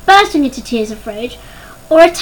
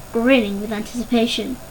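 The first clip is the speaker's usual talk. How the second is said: ordinary delivery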